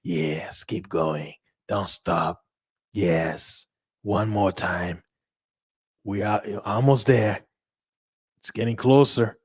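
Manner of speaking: Jack Nicholson. Pretend. Casino cooing low at the player, sly and coaxing. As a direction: purr it low like a smug gambler urging him on. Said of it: "that little Jack Nicholson smile".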